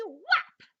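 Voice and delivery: sudden high, loud burst on whap. Acted. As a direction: slam the whap out like a tail slap. Tail slap sound in story.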